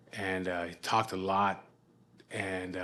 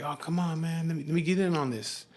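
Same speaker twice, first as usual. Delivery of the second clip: usual speaking voice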